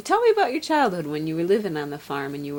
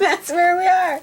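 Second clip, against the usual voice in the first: laughing; 13 semitones higher, 9 dB louder than usual. Real, not acted. Mock-formal repeat, laughing.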